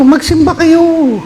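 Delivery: high, drawn-out "Magsimba kayo"; stretched high decree; high slow command. Acted. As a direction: proclaim it slowly, way up high. Preacher voicing Jesus' command.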